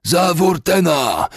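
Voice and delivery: very high, loud, drawn-out shout; war cry. A narrator's reading. Riders echo their commander while chasing fugitives, shouting. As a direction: take up the battle cry. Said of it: "podchwycili jeźdźcy".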